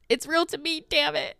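high-pitched mock outburst; emotional fan imitation. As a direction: imitate an overwrought fan pleading. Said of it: "he's getting emotional and he's like".

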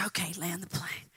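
hushed whispered retelling; secretive whispered quote. Retold or imitated. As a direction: drop to a whisper. Preacher whispering retold story.

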